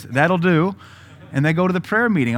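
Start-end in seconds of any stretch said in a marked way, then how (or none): 0.0-0.8 the Lord, lightly voiced. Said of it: "And the Lord says".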